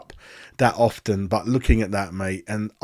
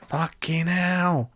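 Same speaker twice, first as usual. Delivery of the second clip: drawn-out, higher, fed-up curse; exasperated groan; 6 semitones higher, much slower than usual. Real, not acted. Fan fuming at his team's defending, slow exasperated curse. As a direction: let the frustration drag out slowly.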